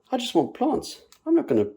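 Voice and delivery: sudden high, loud exasperated burst. Real, not acted. Fed-up gardener blurting out she only wants plants, exasperated. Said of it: "ranting about name tags".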